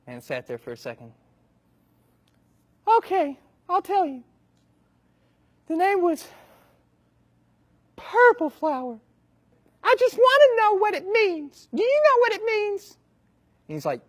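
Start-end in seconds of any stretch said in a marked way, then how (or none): 2.9-13.0 very high, loud, aggrieved voice; high-pitched old man ranting his woes; whiny old man. Acted. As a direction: wail the sad tale like an outraged victim. Old man reveals the name.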